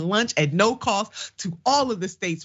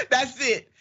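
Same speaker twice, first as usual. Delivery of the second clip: delighted laugh; 8 semitones higher than usual. Real, not acted. Delighted at one-word tweet.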